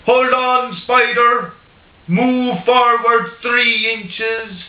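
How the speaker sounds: an Irishman coaxing his spider, in an easy Irish brogue; Irish accent. Retold or imitated. Paddy Irishman ordering the spider about, in a mock Irish accent. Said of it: "I'll do it in an Irish accent".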